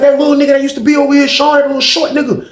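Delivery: high-pitched, rushed explanation; retold excited report. Richmond man telling who got knocked out, retold excited report. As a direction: blurt it out high and hurried.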